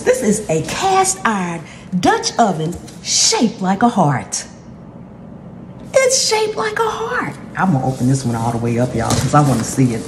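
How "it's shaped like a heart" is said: much higher, delighted squeal; excited squeal. Real, not acted. To viewers about the heart-shaped pot, excited high squeal. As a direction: gush over the heart shape.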